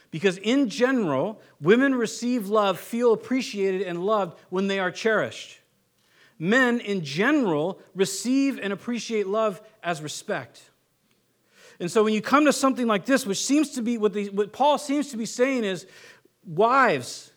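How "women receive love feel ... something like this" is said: ordinary talk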